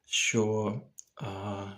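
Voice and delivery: usual speaking voice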